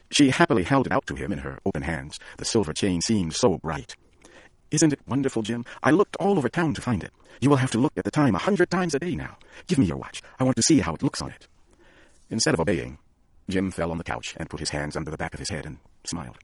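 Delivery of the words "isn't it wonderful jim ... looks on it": eager Della. A narrator's reading. Della eagerly showing her gift.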